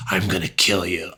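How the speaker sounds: soft, staring menace; heavy, deliberate serial-killer delivery; intense killer threat. Shown as a demonstration. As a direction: bore into them with the threat. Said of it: "looked into the windows of your soul".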